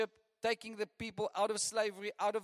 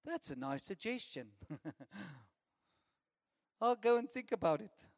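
mock lazy brush-off. Spoiled child brushing off parent.

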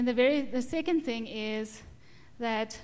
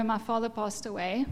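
ordinary talk

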